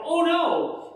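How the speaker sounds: high, loud mock-frightened "Oh no!"; scared gasp. Preacher acts out kids facing scary things, high frightened yelp. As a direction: yelp it like you just saw something scary. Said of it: "we look at and go".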